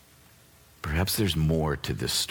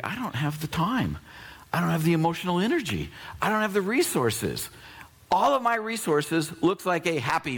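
high, rushing mock overwhelm; overwhelmed believer; 6 semitones higher than usual. Believer facing needs, overwhelmed and anxious. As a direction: pile up every excuse.

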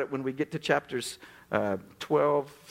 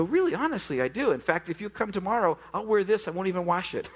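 eager insistence; 6 semitones higher than usual. Retold or imitated. Eager self-quote to neighbour.

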